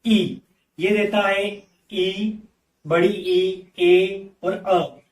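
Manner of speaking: ई, बड़ी ई, ए, अ each said very slowly; slow teacher demo of the four ई sounds; sounds spelled out. Shown as a demonstration. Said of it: "ये चार sound ई letter हमें देता है".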